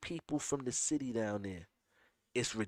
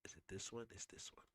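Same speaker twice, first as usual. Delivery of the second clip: whispered while searching; 8 semitones lower, 24 dB quieter than usual. Real, not acted. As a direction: ask yourself under the breath. Searching for the clip.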